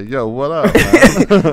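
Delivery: laughing American dude greeting; hammed-up American guy voice; American accent. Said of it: "They say".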